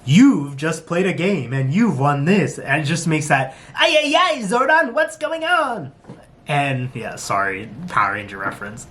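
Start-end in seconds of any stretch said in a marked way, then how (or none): 0.0-2.6 slow, sing-song upbeat announcer; high-pitched announcer. Retold or imitated. Imitating the show's prize announcer, swooping upbeat voice. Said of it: "every time I hear it and he's like". 3.7-5.9 very high cartoon robot-sidekick voice; Alpha. Quoting a Power Rangers character, high-pitched impression. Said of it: "like, Alpha from Mighty Morphin Power Rangers".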